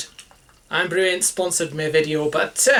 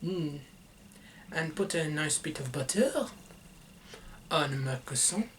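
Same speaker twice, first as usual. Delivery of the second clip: softly to himself; 12 dB quieter than usual. Real, not acted.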